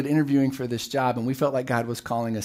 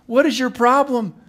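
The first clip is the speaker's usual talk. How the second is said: exasperated groan; 6 semitones higher than usual. Retold or imitated. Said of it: "they groaned".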